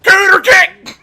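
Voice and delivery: high, loud shouted battle cry; King Angelus battle cry. Retold or imitated. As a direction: belt it like a fighting-game hero. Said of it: "That was King Angelus's line for it".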